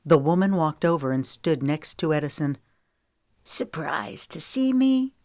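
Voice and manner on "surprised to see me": high, slow taunting woman's voice; a mocking villainess, slow and high; raspy taunt. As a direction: tease him with a slow, raspy sneer. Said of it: "she taunted in a raspy voice".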